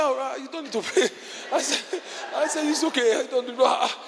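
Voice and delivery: brushing it off with a high laugh; laughing dismissal. Retold or imitated.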